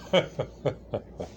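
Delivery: a silent, breathy chuckle; breathy stifled laugh. Retold or imitated. Mister Smith laughing at the receptionist, breathy chuckle. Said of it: "Mister Smith said".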